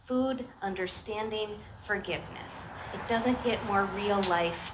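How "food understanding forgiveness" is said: ordinary talk, nothing marked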